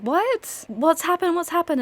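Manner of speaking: high, loud alarmed questions; startled interruption. Acted. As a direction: cut him off in shock.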